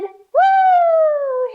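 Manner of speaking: high squealing woo; little mouse squealing with delight; excited woo. Pretend.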